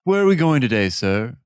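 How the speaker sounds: lightly voiced refined horse; a hint of a snooty gentleman; posh horse voice. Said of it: "this sick-ass voice".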